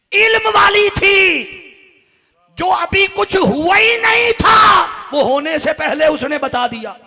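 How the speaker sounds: high, slow, very loud sermon; full-force preaching. Acted. Preacher arguing from the story of Iblis, thundering. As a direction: hammer the argument home in drawn-out shouts.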